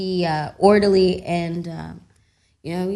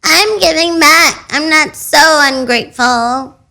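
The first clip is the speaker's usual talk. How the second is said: mock boast; 9 semitones higher, 12 dB louder, slower than usual. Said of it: "Inner voice".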